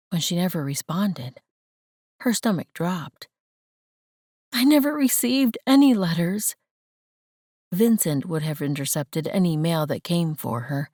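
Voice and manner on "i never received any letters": high, louder shocked protest. Acted. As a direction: blurt it out in shock. Shocked realization.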